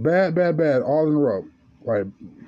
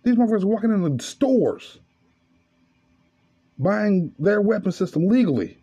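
raised, insistent tirade; heated rant; 5 semitones higher than usual. Real, not acted. Comic railing at lawmakers about doctors, raised and heated. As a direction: keep pushing the outrage higher.

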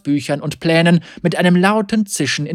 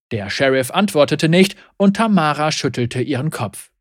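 usual speaking voice

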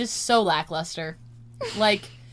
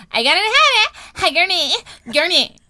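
very high, loud mock Californian pep; hammed-up Californian cheer, drawn out and piercingly high; peppy Californian girl; 11 semitones higher, 12 dB louder, slower than usual. Imitating her favorite TikTok girl, super peppy Californian voice. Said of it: "super peppy and sounds Californian".

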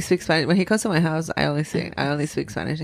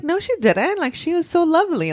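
indignant; 9 semitones higher than usual. Retold or imitated. Defending grandma, high pitch.

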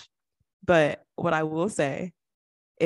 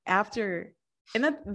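ordinary talk, nothing marked

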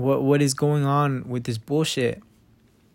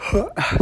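yawn; 7 semitones higher, 3 dB quieter than usual. Real, not acted. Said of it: "Sorry, I'm yawning".

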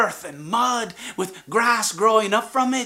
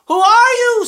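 high, loud called question; calling out; 14 semitones higher, 8 dB louder than usual. Acted. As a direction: call across the distance. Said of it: "said the silver fox".

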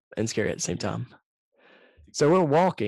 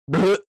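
retching noise; 9 semitones higher, 8 dB louder than usual. Retold or imitated. Friend retches on the concrete.

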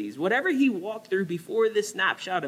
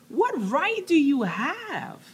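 higher, slow, pressing challenge; challenging; 10 semitones higher, slower than usual. Acted. God challenging Jonah, slow pressing challenge. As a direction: demand it slowly. Preacher voicing God's challenge.

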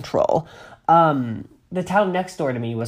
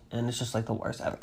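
husky from a cold; 5 semitones lower, 13 dB quieter than usual. Real, not acted. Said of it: "I'm getting over a little bit of a cold".

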